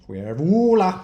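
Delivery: backwards speech. Shown as a demonstration. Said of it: "then you try to record it that way".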